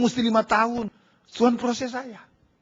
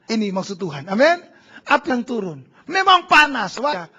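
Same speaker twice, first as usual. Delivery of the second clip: ordinary delivery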